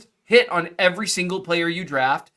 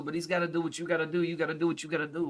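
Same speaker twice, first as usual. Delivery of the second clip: a hint of a tough-guy movie gangster, softer and quick; De Niro parody; 9 dB quieter, faster than usual. Cohost riffs on a mystery guy in a soft, quick hint of a tough-guy voice. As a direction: lightly toss off the line like a tough guy. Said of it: "some kind of De Niro parody".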